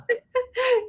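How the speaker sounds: short high giggle. Real, not acted. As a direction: giggle at the joke. Laughing at the poem's punchline.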